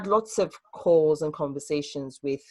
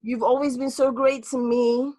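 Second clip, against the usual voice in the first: reassuring friends; 6 semitones higher, 4 dB louder than usual. Retold or imitated. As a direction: gush over them to soothe their guilt. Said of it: "trying to make them feel better".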